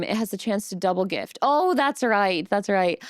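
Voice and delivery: ordinary delivery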